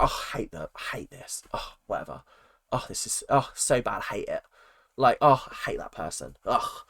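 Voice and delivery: offhand, half-whispered moaning about everything; casual exaggeration. Shown as a demonstration. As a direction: grumble lazily, meaning none of it. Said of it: "I'm just being like".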